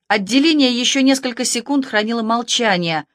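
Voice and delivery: plain reading voice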